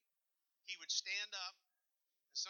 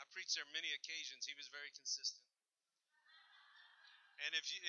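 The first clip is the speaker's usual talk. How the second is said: ordinary talk, nothing marked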